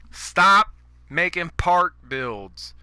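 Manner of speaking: each word spelled out slowly; slow it right down to hammer it home; slow emphatic demand. Real, not acted. Ranting at park-build players, slow and emphatic. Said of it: "ranting, repeated demand".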